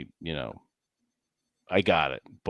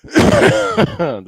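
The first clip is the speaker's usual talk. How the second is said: drawn-out high laughing word; 13 semitones higher, 10 dB louder, much slower than usual. Real, not acted. Friend repeating the joke word, laughing. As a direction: let it dissolve into laughter.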